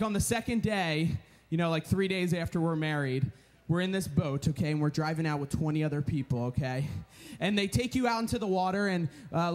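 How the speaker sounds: ordinary talk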